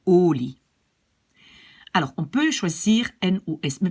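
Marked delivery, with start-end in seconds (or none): 0.1-0.6 "olie" with a loud, long first O; stress on O. Shown as a demonstration. Teacher shows the accent, "olie" stressed on the O. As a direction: hit the O of "olie", let -lie fall away. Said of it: "l'accent c'est sur O".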